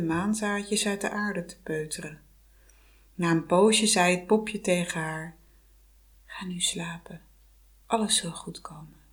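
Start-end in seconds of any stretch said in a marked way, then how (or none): 6.3-9.0 hushed comforting whisper; soothing whisper. Acted. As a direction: whisper her gently to sleep. Popje sust Vasalisa in slaap. (sussend gefluister)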